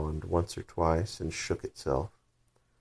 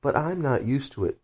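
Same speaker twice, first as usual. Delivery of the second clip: piteous plea; 8 semitones higher than usual. Acted. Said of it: "pleaded poor Alice in a piteous tone".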